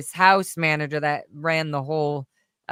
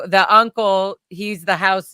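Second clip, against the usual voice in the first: ordinary talk, nothing marked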